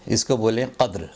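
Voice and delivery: ordinary delivery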